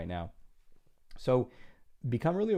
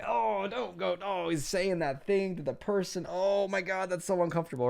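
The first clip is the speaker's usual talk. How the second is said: higher, squirming mock viewer voice; cringing audience member; 8 semitones higher than usual. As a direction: wince through it like a cringing viewer. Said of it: "kinda cringing inside".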